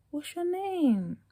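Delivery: drawn-out high "what's your name"; slow high question. Retold or imitated. Imitating the stranger slowly asking her name, high. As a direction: ask it slowly, eerily.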